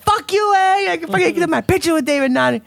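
high, gloating "fuck you, eh"; gloating brag. Retold or imitated. Texting her brother, high and gloating. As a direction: rub it in, triumphant.

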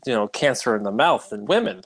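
usual speaking voice

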